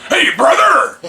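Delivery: high, loud hammed-up rocker call; Corey's brother voice. Imitating Corey greeting a friend, high and loud. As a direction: shout it like the big rocker buddy. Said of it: "he's like".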